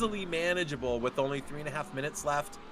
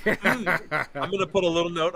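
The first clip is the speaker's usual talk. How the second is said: laughing; 5 semitones higher, 6 dB louder than usual. Real, not acted.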